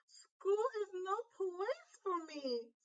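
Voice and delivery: put-on mope like a hurt child; glum child. A narrator's reading. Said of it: "said Chrysanthemum".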